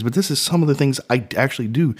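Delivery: usual speaking voice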